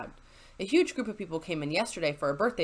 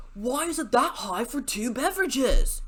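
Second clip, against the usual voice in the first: complaining customer; 5 semitones higher, 3 dB louder than usual. Retold or imitated. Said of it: "he flips".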